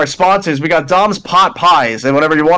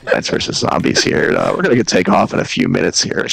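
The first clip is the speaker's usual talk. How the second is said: very low, droning pilot announcement; mock pilot with long deep uhs; airline captain; 11 semitones lower, 3 dB quieter than usual. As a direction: drop way down like a captain addressing passengers. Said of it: "you sound like an airline captain".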